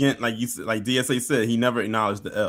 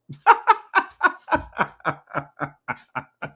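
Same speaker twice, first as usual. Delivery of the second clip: real laugh; 9 semitones higher than usual. Real, not acted. Laughing at the buffoon.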